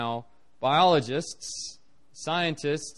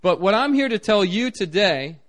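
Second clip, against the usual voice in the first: normal talking voice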